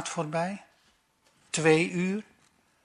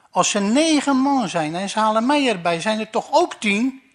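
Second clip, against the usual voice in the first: loud, higher exasperated shout; officer shouting; 4 semitones higher, 7 dB louder than usual. Acted. Officer shouts his logic back at Moos, loud. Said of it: "roept de officier".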